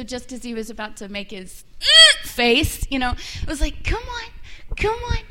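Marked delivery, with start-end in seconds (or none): 3.8-5.2 put-on cooing baby talk to a dog; puppy talk voice. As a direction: coo like talking to a puppy. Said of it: "like, talk to a puppy voice".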